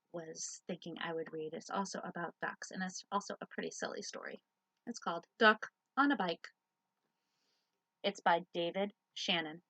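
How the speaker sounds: ordinary delivery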